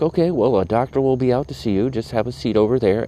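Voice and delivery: mock-chipper nurse voice; mimicking the nurse. Retold nurse instructing him.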